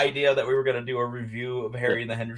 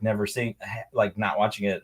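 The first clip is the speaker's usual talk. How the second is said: usual speaking voice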